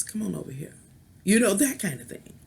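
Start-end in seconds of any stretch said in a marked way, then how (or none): 0.0-0.8 seductive come-on. Pretend. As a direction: coax him closer, hushed. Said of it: "And she says".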